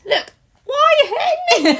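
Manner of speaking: high laughing protest. Real, not acted. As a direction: squeal it through laughter.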